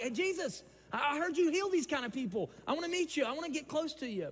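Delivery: high, eager pleading voice; mock the crowd's eager plea. Crowd begs Jesus to meet him, high and eager.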